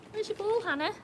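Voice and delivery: high sing-song voice for the dog; dog-talk voice. Real, not acted. Owner cooing at her dog Hannah, high sing-song.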